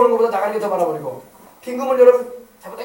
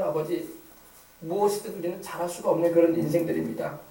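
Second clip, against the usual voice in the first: lower-pitched, earnest pastoral prayer; 5 semitones lower than usual. Real, not acted. Pastor praying to God for the congregation, prayerful. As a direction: pray aloud, swelling on key petitions.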